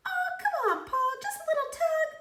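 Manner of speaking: high, coaxing pigtail voice; urging pigtail. A narrator's reading. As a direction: coax him, squeaky and eager. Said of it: "urged the pigtail".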